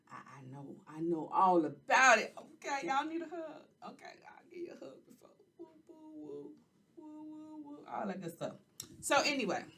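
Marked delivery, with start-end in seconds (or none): 3.9-5.3 soft, fast whisper; whispered hug. Acted. Host offering viewers a hug, quick whisper. 5.6-8.5 soft high playful cooing; hug noises. Acted. Host giving viewers a pretend hug, soft high cooing.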